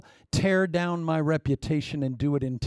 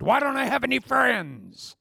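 mock wailing complaint; 8 semitones higher than usual. Preacher mimicking a woman who came to him, high mock wail. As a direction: wail it as a caricature.

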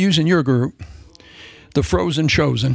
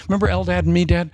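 ordinary delivery

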